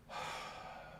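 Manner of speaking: soft, audible breath out; deflated sigh. Shown as a demonstration. Teacher shows students what positive charge feels like, weak sigh.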